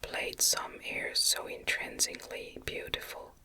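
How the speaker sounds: ordinary delivery, nothing marked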